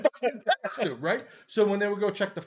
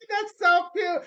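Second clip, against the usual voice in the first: high, loud mock cooing; gushing homeowner; 13 semitones higher, 7 dB louder than usual. Voices homeowners cooing at the costume.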